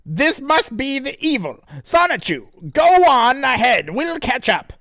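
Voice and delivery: hammed-up high cartoon hero voice, loud; high Sonic voice. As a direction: squeak it out like a cartoon hedgehog. Sonic voiced in comic reading.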